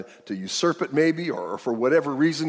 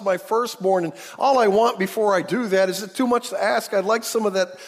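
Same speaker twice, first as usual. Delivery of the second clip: acted plea in a raised pitch; pleading self-justification; 7 semitones higher, 3 dB louder than usual. Old father explaining his last wish, pleading self-justification. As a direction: plead your case higher and insistently.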